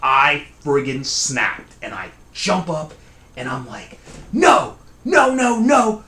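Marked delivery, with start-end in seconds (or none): 4.3-6.0 high, loud angry outburst; furious shout. Retold or imitated. Sleeper snapping at the cousin's never mind, furious shout. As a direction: snap and shout in refusal. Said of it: "like, furious".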